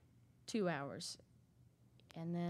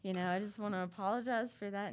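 louder, apology breaking into laughter; laughing apology; 2 semitones higher, 7 dB louder than usual. Retold or imitated. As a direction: laugh through a sheepish sorry.

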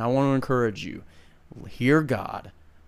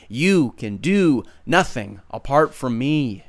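usual speaking voice

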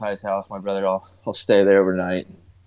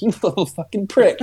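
much higher laughing mock anger; mock insult; 11 semitones higher than usual. Uncle retelling his joking insult, laughing high mock anger. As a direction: fake fury through a laugh. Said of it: "I was like".

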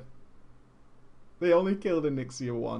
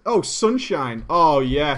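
ordinary talk, nothing marked

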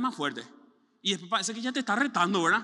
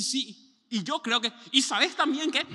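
higher, louder, cutting in; eager interruption; 5 semitones higher, 5 dB louder, slower than usual. Retold or imitated. Listener again trying to interject, eager and loud. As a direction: squeeze in a word.

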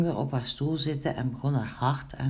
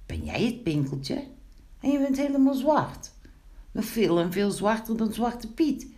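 amazed; 8 semitones higher than usual. A narrator's reading. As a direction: look him over in disbelief. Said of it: "Wat keek die Wiebelstaartje toen verbaasd".

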